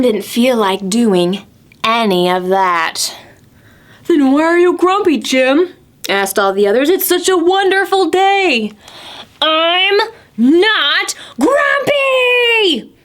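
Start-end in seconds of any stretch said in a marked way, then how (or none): 4.1-8.7 higher, fast cheery voices; chirpy puzzled friends; cheerful friends. Acted. The animals asking grumpy Jim why, cheery. 9.4-12.9 slow, high grumpy shout. Acted. Jim shouting at the others, grumpy yell. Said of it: "Jim shouted".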